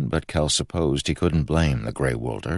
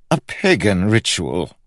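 higher, louder scornful king; 6 semitones higher, 5 dB louder than usual. A narrator's reading. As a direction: dismiss it with royal disdain. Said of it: "he said scornfully".

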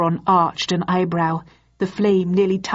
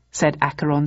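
plain reading voice

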